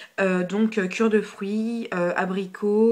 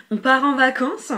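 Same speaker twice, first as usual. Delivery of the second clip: loud, higher holiday cheer; playful excitement; 5 semitones higher, 8 dB louder than usual. Pretend. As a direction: call it out like setting off on holiday. Playful cry at savannah look. (exclamation enjouée, plus haute et forte)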